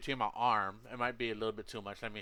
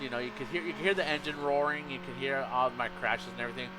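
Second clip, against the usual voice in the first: normal talking voice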